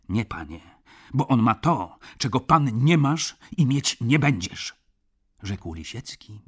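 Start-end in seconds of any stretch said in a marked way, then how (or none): 0.1-4.8 louder, stress on bo on ma to; a proud clerk thumping his chest; fervent retort. Acted. Clerk Lisiecki rebukes Szlangbaum, loud and fervent. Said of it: "bijąc się w piersi".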